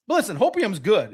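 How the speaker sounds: usual speaking voice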